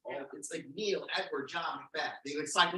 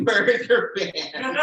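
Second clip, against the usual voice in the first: louder, higher, drawn-out question; slow it right down and belt it out; mock-keen, stretched question; giving in eagerly; 4 semitones higher, 9 dB louder, slower than usual. Gives in and asks eagerly.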